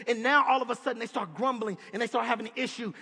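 ordinary talk, nothing marked

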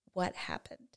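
soft, calm parent asking. Acted. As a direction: ask it softly, without raising your voice. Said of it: "I'm going to lower my voice".

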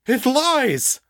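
high loud outraged protest. A narrator's reading. The father denying the demon's accusation, outraged. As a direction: cry out in denial. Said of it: "the man protested".

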